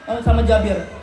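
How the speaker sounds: normal talking voice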